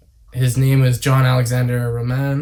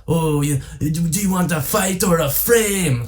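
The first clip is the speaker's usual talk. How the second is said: drawn-out, higher French Canadian voice; put-on French Canadian accent; 4 semitones higher than usual. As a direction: imitate a French Canadian, slow and pitched up. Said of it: "very good French Canadian accent".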